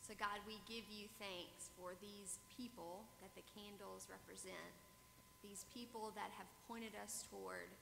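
soft, hushed prayer voice. Acted. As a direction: pray it quietly and reverently. Opening prayer, far softer.

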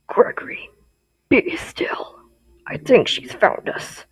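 hushed, whispered warning; soft, breath-only character impression; Glamrock Freddy. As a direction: whisper as the robot hiding with a child. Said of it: "Glamrock Freddy already has a voice".

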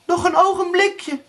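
very high, pleading call. A narrator's reading. Erika stalls Blauwbaard, calling out high. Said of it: "riep Erika".

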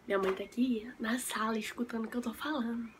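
real whisper so mom won't hear. Real, not acted. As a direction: whisper it like a secret. Mom listening in the room.

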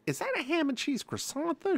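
high innocent question about the croissant; high sweet question. Retold or imitated. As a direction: ask it high and innocent, like you already know. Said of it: "I was like".

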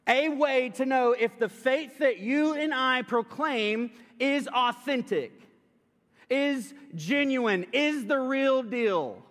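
solemn pulpit emphasis, drawn out; emphatic preaching. Acted. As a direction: lay each word down slowly for the congregation. Builds to the sermon theme.

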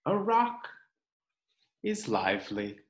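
expressive storybook read-aloud; reading to children. Acted. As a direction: read it like story time for little ones. Reads picture book to children.

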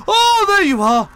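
high, loud startled exclaim; startled shriek. Real, not acted. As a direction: shriek in sudden fright. Startled by character in game.

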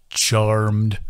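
gentle bowing greeting; a polite old host; courtly creature greeting; low courtly charmed. A narrator's reading. Bog bows politely.

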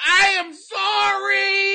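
high, loud, slow wailing sorry; fake sobbing apology. Comic picking the millions, hammed-up wailing apology. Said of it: "you can have millions of dollars or you can apologize".

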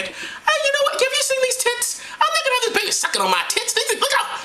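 high falsetto rant; comic high-pitched outrage; indignant Mary. Acted. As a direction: rush it out in a high put-on woman's voice. Acting Mary ranting about breastfeeding.

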